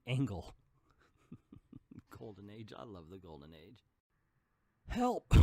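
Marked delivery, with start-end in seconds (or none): 4.9-5.3 high, loud call for help, acted; cry for help. Trapped man in the fire crying out loud for help. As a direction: shout it out from the flames. Trapped victim calls from burning room.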